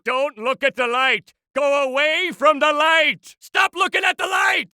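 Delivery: increasingly panicked orders; escalating warning. Acted. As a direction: build from warning to a high frantic shout.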